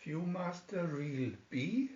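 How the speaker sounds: usual speaking voice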